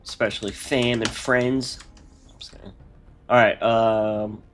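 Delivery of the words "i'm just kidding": breathy whispered take-back; whispered aside. Real, not acted. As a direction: whisper it to yourself. Repeats joke retraction, hushed.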